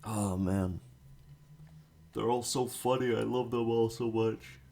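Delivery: talking through a yawn; stretched out, sleepy yawn. Real, not acted. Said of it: "I'm also yawning a lot".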